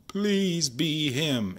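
long, drawn-out high plea; pleading. Real, not acted. Collector begging the next card to be him, pleading. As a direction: beg slowly, stretching every word.

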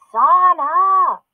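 a big sister yelling across the house in fury; furious shout. A narrator's reading. Big sister discovering her lollipop eaten, furious shout. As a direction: shout the sister's name, high and loud, outraged.

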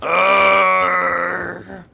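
loud frustrated groan; furious gamer growl; raging gamer. Real, not acted. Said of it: "I'm already mad".

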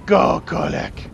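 rally the troops; battle cry. Real, not acted. Cheering battle charge.

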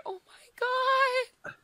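very high, slow, drawn-out squeal; squealing disbelief. Real, not acted. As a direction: stretch it out in happy shock. Cannot believe getting Defy.

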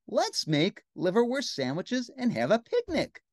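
put-on high, eager child voice; little girl. Girl suggests a picnic, high eager voice. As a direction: propose it high, slowing at the end. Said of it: "said the little girl".

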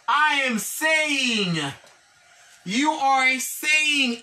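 each word spaced out slowly for the class; slow model reading. Shown as a demonstration. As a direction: say it slowly so students can repeat. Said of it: "teacher models English slowly".

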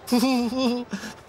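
high, smug character laugh; gleeful laugh. Acted. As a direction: laugh like you were proved right. Character laughs before boasting.

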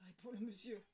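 ordinary delivery

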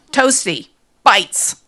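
loud, punched two-word slogan; proclaimed accusation. A narrator's reading. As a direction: announce each word like a headline. Imagined accusing poster text, proclaimed.

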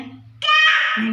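long, very high, loud "नहीं"; drawn-out scolding no. Real, not acted. Scolding baby. (बच्चे को रोकते हुए लंबा, ऊँचा "नहीं")

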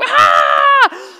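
high, loud charging yell; battle scream. Retold or imitated. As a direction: scream as you leap at the lion.

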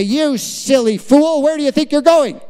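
hammed-up scolding, pitch climbing high; mock scolding. Preacher imitating a GPS berating a lost driver, mock scolding. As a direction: play an exasperated GPS telling off the driver. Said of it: "No, it gets very gentle".

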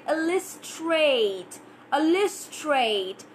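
"illustrate" slowly, the final "ate" as a clear long A. Shown as a demonstration. Teacher modelling "illustrate" for students, slow long A. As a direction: stretch the A in "illustrate". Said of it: "making this A sound a little bit A".

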